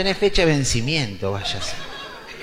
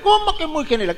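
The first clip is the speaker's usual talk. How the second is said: much higher incredulous protest; a mild mock outrage from the old man; indignant grandpa; 13 semitones higher, 3 dB louder than usual. Grandpa protests the officer's answer, higher and incredulous.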